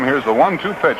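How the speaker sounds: usual speaking voice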